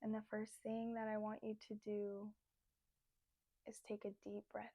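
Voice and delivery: soft, unhurried meditation voice with long pauses; guided meditation. Acted. Leads guided breathing meditation.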